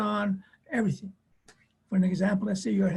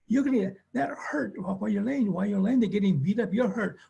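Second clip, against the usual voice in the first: ordinary delivery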